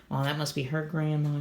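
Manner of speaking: ordinary delivery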